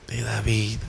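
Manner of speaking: very soft, low reply; quiet refrain answering own question; hushed answer. Real, not acted. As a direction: drop right down, almost under the breath.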